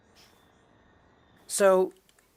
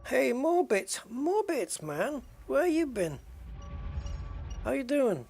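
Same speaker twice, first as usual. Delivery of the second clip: cheery greeting; 9 semitones higher than usual. Real, not acted. Greeting another player in game.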